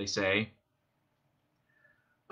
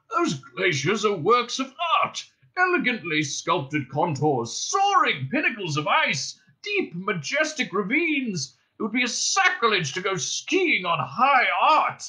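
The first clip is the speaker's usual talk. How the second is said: high, slow passionate plea; rhapsodize, wounded; impassioned old man; 8 semitones higher than usual. A narrator's reading. Old man defends his art.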